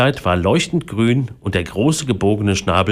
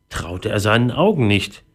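ordinary delivery

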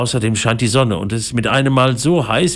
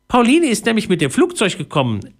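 high, chirpy mouse explanation; squeaky little voice; 11 semitones higher than usual. A narrator's reading. Mouse explaining her guest to the family, squeaky voice. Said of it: "ein piepsendes Stimmchen".

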